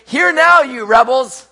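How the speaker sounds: loud, high, harsh rebuke; Moses speaking harshly. Acted. Moses rebuking the rebels, harsh shout. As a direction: shout it with stern authority. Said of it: "he spoke harshly to the people".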